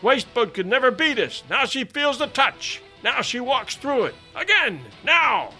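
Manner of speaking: high, loud broken shouts; cheering exclamations. A narrator's reading. Boat officer cheering his rowers after the whale, excited shouting. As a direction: urge the rowers on, burst by burst. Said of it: "broken exclamations and adjurations with which I cheered".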